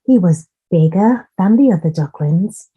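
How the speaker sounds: ordinary delivery, nothing marked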